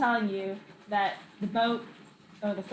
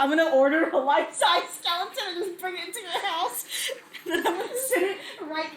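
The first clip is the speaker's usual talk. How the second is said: loud laughing threat; 5 semitones higher, 3 dB louder than usual. Real, not acted. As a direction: cackle through the threat.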